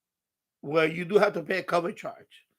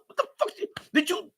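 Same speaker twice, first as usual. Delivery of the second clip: mock disbelief; 10 semitones higher, 5 dB quieter, faster than usual. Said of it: "I say".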